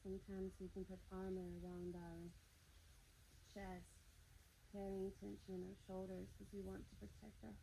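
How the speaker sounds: hushed guided meditation. Acted. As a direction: ease them gently inward. Soft guided meditation lines.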